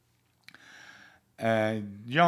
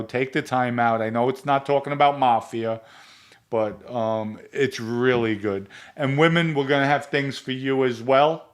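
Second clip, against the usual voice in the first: louder, pushy sponsor pitch to the audience; ad read; 6 dB louder than usual. Acted. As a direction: sell it to the viewers with energy. Sponsor plug for the viewers.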